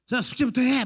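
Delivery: sharp, loud, high scold; nagging parent order. Retold or imitated. Parent scolding child just home from school, loud and sharp. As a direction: bark the homework order at the kid. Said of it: "막 이렇게 하지 말고".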